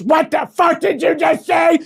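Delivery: loud high indignant yell; angry shout. Pretend. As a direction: bark it back at the heretic. Acted holy war quarrel.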